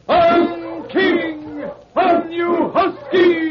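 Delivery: high ringing shout urging the husky on; calling the dogs. Acted. Musher shouting at his lead dog. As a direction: yell to the lead dog over the wind.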